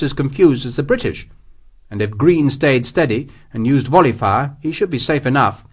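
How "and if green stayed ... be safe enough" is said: ordinary delivery, nothing marked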